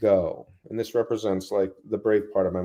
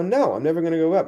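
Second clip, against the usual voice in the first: higher, quick and eager; eager insistence; 8 semitones higher, 4 dB louder, faster than usual. Retold or imitated. Telling how he answered the friend, eager and fast. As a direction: insist brightly you'll never quit. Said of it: "And I go".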